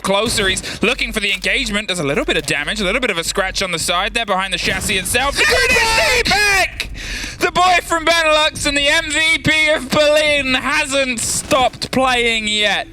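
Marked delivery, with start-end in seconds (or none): 5.4-12.9 excited. Real, not acted. Caster calls a big play.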